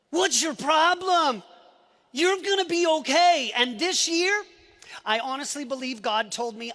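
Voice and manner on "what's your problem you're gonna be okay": mock tough love. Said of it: "giving talks all over the world about suck it up".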